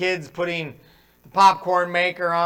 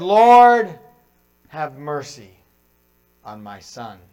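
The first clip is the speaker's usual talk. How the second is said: dramatized plea that sinks away; acted plea; 4 semitones lower, 5 dB louder, slower than usual. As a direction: plead for mercy, fading to a hush. Acted father's plea to Jesus.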